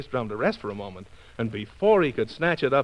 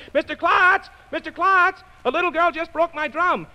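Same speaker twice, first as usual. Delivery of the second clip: put-on high, urgent boy's call; 13 semitones higher than usual. Drummer boy calls to the shop owner, urgent. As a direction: call across the street for help. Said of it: "calling out to Mister Klotz".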